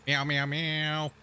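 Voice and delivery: slow, drawn-out mock meows; imitating a cat. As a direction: meow back at the cat, slowly. Meowing at the cat.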